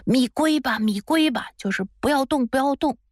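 narrator's restrained imitation of villagers shouting; mild mock shouting. A narrator's reading. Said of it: "大家就开始大声地喊道".

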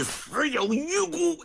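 sudden high outcry of condemnation; old general condemning Xue Dingshan, high outcry; scolding cry. A narrator's reading. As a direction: throw the verdict up high and long. Old man berates Xue Dingshan.

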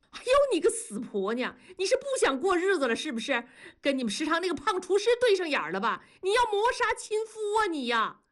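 very high, fast hysterical wailing; shrewish tantrum. A narrator's reading. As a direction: wail and rant like an old shrew. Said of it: "像个老娘们儿似的撒泼地说". (像老娘们儿似的撒泼哭闹)

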